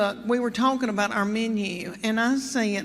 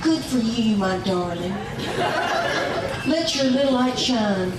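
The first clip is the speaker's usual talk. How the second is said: mock sweet blessing. Mock blessing the young girls.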